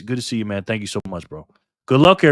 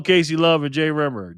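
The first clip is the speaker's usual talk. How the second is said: normal talking voice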